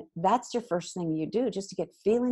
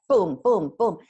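punchy booms; 9 dB louder than usual. Retold or imitated. Imitating rapid venting.

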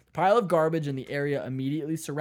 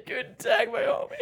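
tourist German. Retold or imitated. Retold habitual greeting.

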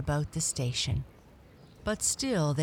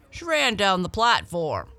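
raised, higher bystander voice; calling out; 4 semitones higher, 6 dB louder than usual. A narrator's reading. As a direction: call the answer across the platform. Bystander man calls out answer.

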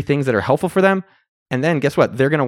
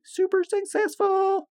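hammed-up squeal; 4 dB quieter, much slower than usual. Hammed-up high sign-off.

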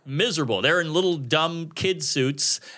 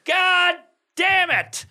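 angry outburst; 7 semitones higher, slower than usual. Retold or imitated. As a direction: stand up and blow your top. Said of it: "one guy stands up and goes".

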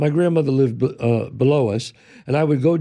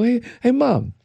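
put-on higher, eager kid voice; 8 semitones higher than usual. Boy calling mom after coming upstairs, lightly kid voice. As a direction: play the little boy calling his mom. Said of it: "and I'm this kid now".

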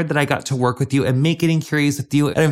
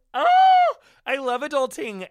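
excited squeal; 12 semitones higher than usual. Acted. Gushing over adulting in ad.

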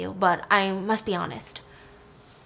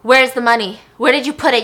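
angry demand; 3 semitones higher, 8 dB louder than usual. Retold or imitated. Stan confronting Lilith over money.

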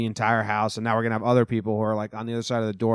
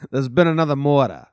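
put-on southern accent; 3 semitones higher, 7 dB louder than usual. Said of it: "a southern accent".

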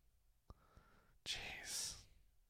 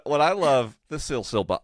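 normal talking voice

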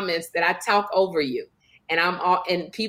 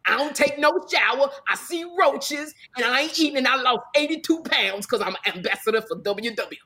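big, higher, hammed-up co-host send-up; mocking co-host imitation; 3 semitones higher than usual. Comedian mocking her co-host cutting in, hammed-up sass. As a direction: mimic your co-host at her most unruly. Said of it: "when you imitate me".

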